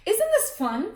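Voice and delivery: put-on high, loud gleeful kid. A narrator's reading. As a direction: crow it with delight. Wendell gloating again.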